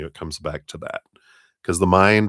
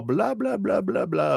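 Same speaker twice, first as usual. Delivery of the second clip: mocking blah blah; 13 semitones higher than usual. Mocking chattering advisors.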